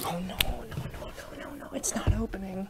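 half-whispered, fretful muttering; panicked muttering. Acted. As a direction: whisper anxiously to yourself. Struggling with locker, talking to himself.

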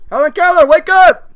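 loud, high wake-up call. Pretend. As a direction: shout to rouse someone who won't answer. Calling out to an unresponsive stranger.

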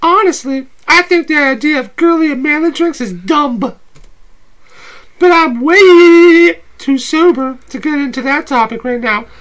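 playful date voice, high and bright; high girly voice. Acted. S0 holds Abby voice.

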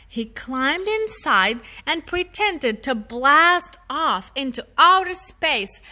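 usual speaking voice

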